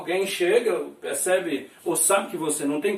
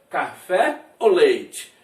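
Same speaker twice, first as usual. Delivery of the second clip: slow translation; 5 dB louder, much slower than usual. Shown as a demonstration. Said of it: "o que você prefere?".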